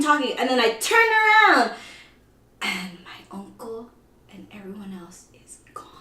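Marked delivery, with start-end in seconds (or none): 2.6-5.9 dropping into a dramatic near-whisper; hushed reveal. Retold or imitated. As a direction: let it sink to a stunned whisper. Reveals family vanished, hushed.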